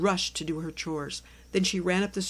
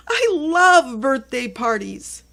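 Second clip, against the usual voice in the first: high, loud, slow gush of joy; excited; 7 semitones higher, 11 dB louder, much slower than usual. Acted. Cookie jumping up for the party, loud and thrilled. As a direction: burst out with delight.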